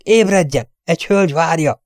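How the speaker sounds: put-on old servant rousing the prince, scandalised; indignant old butler waking his master. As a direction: shake him awake with fuming indignation. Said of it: "Hangjából csak úgy füstölgött a mélységes felháborodás".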